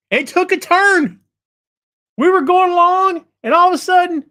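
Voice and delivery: high, animated storytelling; excited buildup. Real, not acted. Host recounts how the feud suddenly turned, excited.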